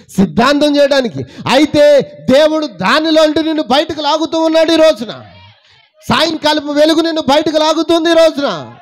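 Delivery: high, loud, ringing preacher's cry; full-force preaching. Acted. Preacher lifts his voice loudly to the congregation. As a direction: proclaim it loudly to the church.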